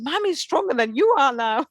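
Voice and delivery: put-on high, teasing little child; child's voice. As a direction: tease like a cheeky kid. Son's words voiced.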